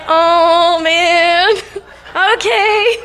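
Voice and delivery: high, laughing overwhelmed sigh; laughing, overwhelmed by the proposal. Retold or imitated. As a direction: let it spill out through laughter. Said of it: "And I'm like".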